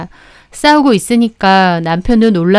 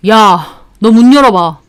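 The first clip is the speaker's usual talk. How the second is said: angry brother; 5 dB louder than usual. Acted. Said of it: "화가 나서 신우 방으로".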